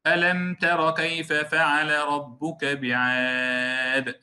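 slow, measured Quranic reading with "ألم" not over-held; correct recitation. Shown as a demonstration. Teacher modelling the verse for students, slow careful recitation. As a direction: recite slowly, moving from the م of "ألم" straight into "تر". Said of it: "هكذا".

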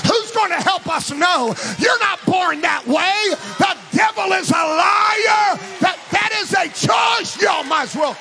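full-force preaching build. Acted. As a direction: sound the alarm from the pulpit. Sounds the alarm, building sermon.

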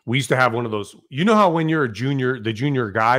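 ordinary delivery

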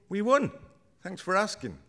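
high, happy bragging; proud and bright. A narrator's reading. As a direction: beam with pride. Fiona proud of the win.

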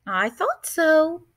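slow, knowing and pleased; old woman amused, gentle; smiling. A narrator's reading. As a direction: smile through the words. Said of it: "said with a smile".

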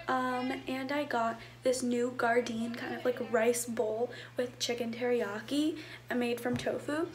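hushed vlog chatter. Real, not acted. Hushed talk in store or home.

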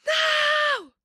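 high anguished cry of no; anguished shout. Real, not acted. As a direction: wail it like betrayed. Friend left the game.

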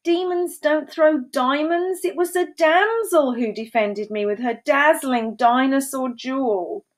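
high-pitched proclamation; storybook roar. A narrator's reading. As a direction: proclaim it over the din. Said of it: "roared Duke Dudley through the din".

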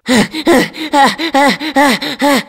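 long high laugh; cheerful laughter. Acted. Laugh alone on a line.

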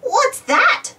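high, eager question from a little mixer; bursting with curiosity; excited. A narrator's reading. Dizzy asking Bob about the catalogue, excitedly. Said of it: "Dizzy asked excitedly".

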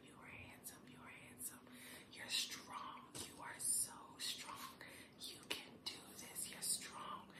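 soft whispered affirmations; whispered pep talk. Acted. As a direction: whisper encouragement close to the mic.

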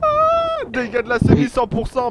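ordinary talk, nothing marked

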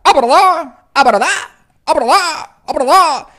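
"verdad" swooped into a strangled cry; cracked, throaty swoop; swallowed scratch voice. Shown as a demonstration. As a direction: bend "ah, verdad" up and choke it. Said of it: "meter más la voz".

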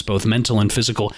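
ordinary talk

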